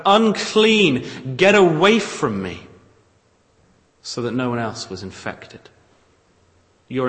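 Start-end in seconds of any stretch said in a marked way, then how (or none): 0.0-2.7 higher and louder shouted warning; warning cry. Retold or imitated. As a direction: push people away with the cry. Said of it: "You cried out".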